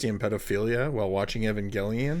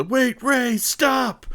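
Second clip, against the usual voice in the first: high, louder cry to halt someone; imitated desperate yell; 5 dB louder, much slower than usual. Host mimicking a character yelling at Rei to stop, desperate yell. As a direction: mimic him yelling out of character, pitch leaping up. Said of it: "The way he yells".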